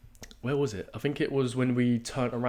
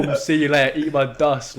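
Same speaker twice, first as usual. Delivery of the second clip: ordinary delivery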